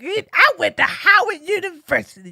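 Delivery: high-pitched mock protest; indignant sore loser. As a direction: pipe up in put-on indignation. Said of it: "She was like".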